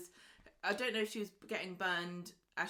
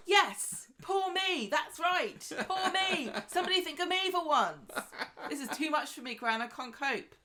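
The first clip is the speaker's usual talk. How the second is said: high, loud melodramatic wail; mock Alia self-pity; 10 semitones higher, 9 dB louder than usual. Mocking Alia wallowing to Gran, high and loud. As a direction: ham up the poor-me victim. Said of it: "And then Alia's like".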